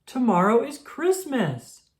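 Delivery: joyous Christmas cheer; excited. Acted. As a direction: announce it with pure glee. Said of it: "Rubble asked".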